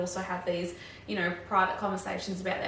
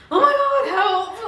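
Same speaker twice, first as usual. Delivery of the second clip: much higher, louder laughing plea; mock cry for help; 12 semitones higher, 10 dB louder than usual. Overwhelmed grad begs visiting preceptor for help, mock yelp. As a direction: throw up your hands and yelp for rescue. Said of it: "it's like".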